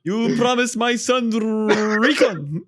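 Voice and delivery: slow, high mock Indian accent breaking into a laugh. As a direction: imitate the Indian accent again, slowly, laughing. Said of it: "like that Indian guy".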